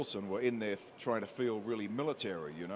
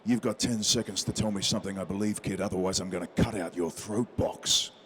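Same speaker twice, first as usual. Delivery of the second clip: menacing line read; 5 semitones lower than usual. Shown as a demonstration. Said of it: "and says".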